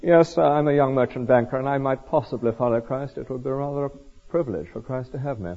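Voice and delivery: quiet, self-important merchant banker; mock sophisticated. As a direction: murmur it softly, as if granting a favour. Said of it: "in a rather sophisticated way".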